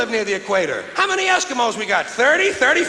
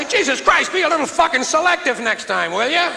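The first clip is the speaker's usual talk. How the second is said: exasperated shout; 7 semitones higher than usual. Retold or imitated. Comic scolding the burglar, exasperated shout. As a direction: yell at him to be choosier. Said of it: "I say".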